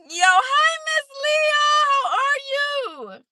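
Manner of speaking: excited greeting. Real, not acted. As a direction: squeal a long hello to a friend. Greets a viewer joining the stream.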